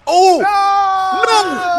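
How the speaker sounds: high shocked cry of no; alarmed outcry. Acted. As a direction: yell it out in alarm. Alarmed outcry at the Titan's path.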